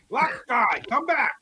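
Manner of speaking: high-pitched playful yell; yelling in Orcish. Pretend. Player calls a runaway companion back, yelling. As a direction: call out high to someone running off. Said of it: "I'm gonna yell at him in Orcish".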